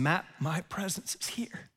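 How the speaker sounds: hushed, breathy whisper of God's voice. Retold or imitated. Pastor voicing God whispering to him in prayer, whisper. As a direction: breathe it softly like a voice inside the chest. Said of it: "speaking into my chest saying".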